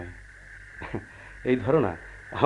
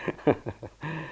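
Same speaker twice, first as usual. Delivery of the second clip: soft laugh; 3 semitones higher, 7 dB quieter than usual. Acted. Husband laughs at her threat.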